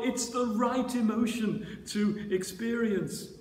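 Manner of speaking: ordinary talk, nothing marked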